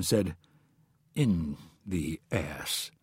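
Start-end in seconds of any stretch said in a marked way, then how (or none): 1.1-2.9 low, slow, soft admission; slightly embarrassed. A narrator's reading. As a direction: confess it haltingly, a bit sheepish. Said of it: "in a slightly embarrassed tone".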